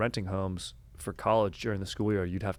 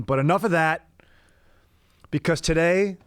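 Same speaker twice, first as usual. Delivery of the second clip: big, slow showman intro, pitched up high and loud; announcer voice; 8 semitones higher, 7 dB louder, slower than usual. Acted. Host tells listeners it's a dank off, booming announcer voice. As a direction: hype the crowd like a game show host. Announcing a special podcast episode.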